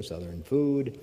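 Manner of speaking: usual speaking voice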